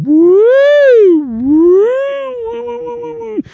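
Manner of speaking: high wailing "woo" siren; imitated alarm siren. Alarms going up on a sinking ship, imitated siren. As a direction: mimic a blaring alarm with rising wails. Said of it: "That's weird sounding alarms".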